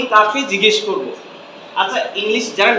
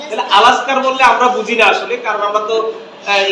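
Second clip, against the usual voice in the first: usual speaking voice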